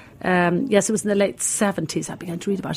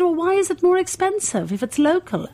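puzzled tourist; 11 semitones higher than usual. Retold or imitated. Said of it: "And I said".

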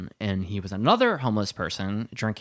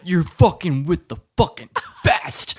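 loud, slow, swaggering street threat; tough guy boast; 5 semitones higher, 8 dB louder, much slower than usual. Retold or imitated. Drunk homeless man threatening the woman, slow and swaggering. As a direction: puff up and threaten her. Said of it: "he's like".

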